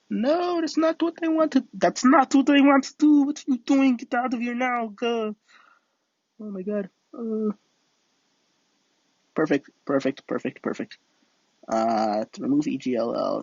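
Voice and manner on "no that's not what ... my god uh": much higher, urgent no and get out; real alarm as things go wrong; panicked protest. Real, not acted. Streamer yells at the sim going wrong, high panicked protest.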